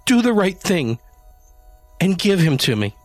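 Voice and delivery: high, loud urgent command; high insistent demand. A narrator's reading. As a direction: order him to hand it over. Janie demands the kitten.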